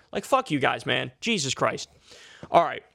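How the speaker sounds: ordinary talk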